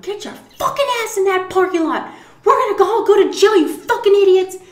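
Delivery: laughing mock yell. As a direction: yell at him while cracking up. She yells at Aaron, laughing.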